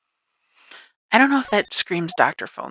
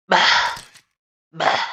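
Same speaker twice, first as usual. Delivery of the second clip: frustrated groan; 4 dB louder than usual. Real, not acted. Frustrated at dead end.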